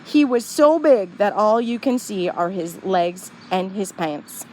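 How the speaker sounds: ordinary delivery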